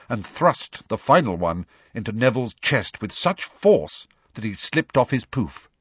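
ordinary delivery

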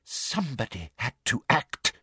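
soft, breathed confession. A narrator's reading. Umbridge admits to Harry what she did, breathed softly. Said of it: "breathed Umbridge".

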